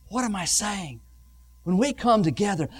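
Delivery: usual speaking voice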